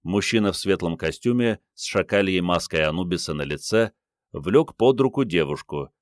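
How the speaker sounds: plain reading voice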